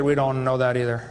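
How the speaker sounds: low, lightly voiced professor; mock professor. Professor admitting to a questioner that he doesn't know, mock low voice. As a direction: imitate a professor's answer, pitched right down. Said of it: "I asked this professor".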